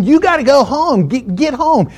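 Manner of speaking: high-pitched pleading order; acted-out exasperated send-off; insistent command. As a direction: shoo a stubborn dog away. Repeatedly shooing the dog away.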